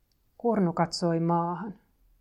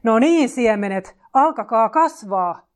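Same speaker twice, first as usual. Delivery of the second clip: loud, higher-pitched drawn-out shout; roar; 4 semitones higher, 10 dB louder than usual. A narrator's reading. Kurnu roars at seeds that will not grow, full shout. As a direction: roar the order, each word stretched.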